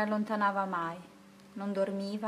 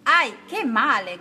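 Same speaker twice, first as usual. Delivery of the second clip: monkey's pained cry; 3 semitones higher, 8 dB louder, slower than usual. Acted. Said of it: "gridò la sciam-, scimmietta piangendo".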